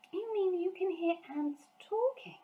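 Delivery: put-on high, disbelieving little-girl question; can't quite believe it; astonished girl. A narrator's reading. Sophie doubting the giant about ants, high astonished girl.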